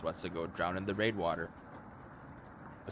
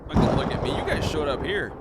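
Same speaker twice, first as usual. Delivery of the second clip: louder, higher, indignant protest; defensive outburst; 6 semitones higher, 6 dB louder than usual. Real, not acted. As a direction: throw it back at them, offended.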